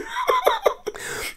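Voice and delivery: high-pitched giggle; very high squeaky laugh. Real, not acted. Still cracking up at the blood in his hair, a very high giggle. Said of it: "that was so funny".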